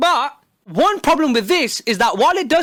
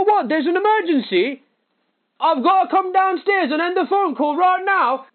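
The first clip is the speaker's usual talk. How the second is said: hammed-up calling to another room, higher; fake distracted call; 4 semitones higher than usual. Caller faking an emergency to end the call, hammed-up. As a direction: pretend a family member is shouting. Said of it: "It'd go a little something like this".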